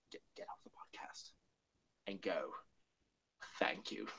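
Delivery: very soft, halting Doctor voice; Troughton impression. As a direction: drop to a near whisper. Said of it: "my Charlton impression".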